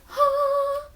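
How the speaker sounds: high, startled huh; stunned huh. Retold or imitated. Viewer surprised by the killing, high startled huh. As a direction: jolt of surprise. And I was like.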